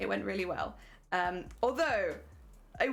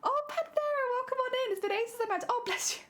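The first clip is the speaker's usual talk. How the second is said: sing-song welcome; 13 semitones higher than usual. Real, not acted. Greeting a viewer on stream.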